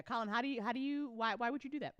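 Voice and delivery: ordinary talk, nothing marked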